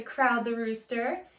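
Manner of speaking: usual speaking voice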